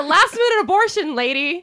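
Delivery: shouting through laughter; laughing. Real, not acted. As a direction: laugh it out loud.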